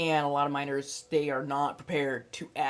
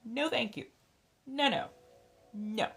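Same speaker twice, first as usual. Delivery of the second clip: much higher, laughing, I can smell the milk on your breath; mock disgusted voice; 10 semitones higher than usual. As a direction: recoil in high squeaky disgust. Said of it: "it's just like".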